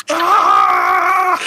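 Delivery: high shrieking pumpkin scream. Acted. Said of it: "It just screams at you".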